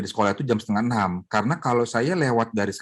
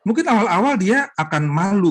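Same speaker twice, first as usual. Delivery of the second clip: usual speaking voice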